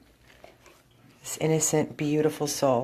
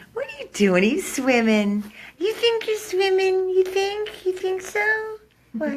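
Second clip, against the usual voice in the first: much higher, louder squeal to the dog; 6 dB louder than usual. Real, not acted. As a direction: tease the dog in a squeaky pet voice. Playful baby talk to dog.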